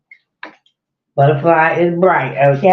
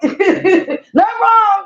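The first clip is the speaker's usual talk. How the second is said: laughing; 13 semitones higher, much slower than usual. Real, not acted. Laughing off avatar joke.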